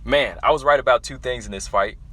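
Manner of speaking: ordinary delivery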